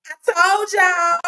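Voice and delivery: high, drawn-out Southern "I told y'all"; Southern accent. Shown as a demonstration. Streamer teasing viewers in a Southern accent. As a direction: sing it out in a Southern drawl. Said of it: "my Southern accent".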